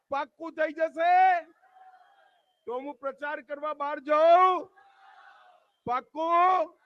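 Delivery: loud, high calls for a promise; rallying questions. Real, not acted. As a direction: press the crowd to confirm. Seeking loud promise from crowd. (ભીડ પાસે પાક્કું કરાવતો ઊંચો અવાજ)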